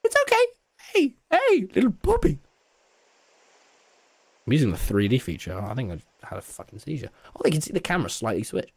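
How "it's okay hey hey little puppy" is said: high cooing pet voice. Real, not acted. Talking to a pet, high sweet baby talk. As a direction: coo sweetly at the little animal.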